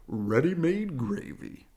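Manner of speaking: slow, pleased bear father; Papa Bear joking about his rusty stew; cheerful Papa Bear. Acted. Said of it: "he said cheerfully".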